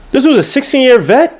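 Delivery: high-pitched incredulous question. Real, not acted. Host totting up the player's seasons, incredulous. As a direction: ask it in real disbelief, pitch way up.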